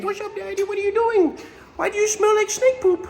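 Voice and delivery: high squeaky little otter voice; otter's voice. Pretend. Keeper voicing what the otter says to him, high squeaky voice. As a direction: voice the otter as a cheeky little critter. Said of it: "He's just saying".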